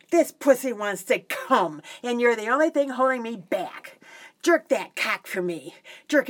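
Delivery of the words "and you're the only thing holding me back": normal talking voice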